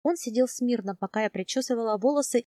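ordinary delivery

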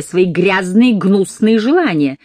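loud, slow, disgusted fury; furious. Acted. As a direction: lean on every word in rage. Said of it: "её бешенство глубоко и неподдельно".

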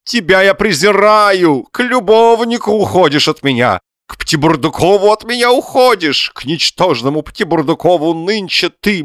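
high, loud, chanting verse tirade; lamenting in iambs. A narrator's reading. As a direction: wail it metrically, revelling in grief. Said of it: "говорит пятистопным ямбом".

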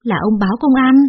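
snapping. A narrator's reading. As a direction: glare and snap at the husband. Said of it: "trợn mắt nhìn lão chồng già quát". (quát chồng, giọng cao và to)

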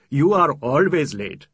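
a host mock-scolding a friend; host's dry tease. Host teases his late guest.

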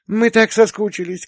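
high and loud, bursting with joy; a little girl yelling how much she missed him; child's excited shout. A narrator's reading. Elder daughter shouts to her returning dad. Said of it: "кричала старшенькая".

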